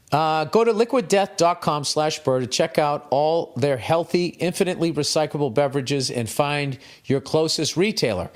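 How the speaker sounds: a brisk host-read ad. Acted. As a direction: sell it like a radio spot. Sponsor ad read mid show.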